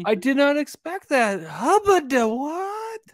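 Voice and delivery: high, slow, stretched-out hubba de what; mock delighted surprise. Imagining the reaction to a surprise pizza, drawn out and high. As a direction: gush with exaggerated delight.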